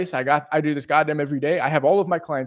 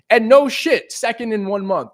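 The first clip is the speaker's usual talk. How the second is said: higher and louder, bragging; excited boast; 6 semitones higher, 5 dB louder than usual. Real, not acted. Boasting to viewers about outperforming coworkers, excited. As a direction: punch it out with pride. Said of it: "boasting outperforming".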